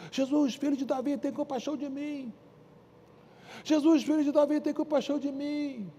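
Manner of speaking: calling out high and pleading to Jesus; a blind beggar crying out over the crowd; high pleading cry for mercy. Acted. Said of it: "aí começou a gritar mais alto".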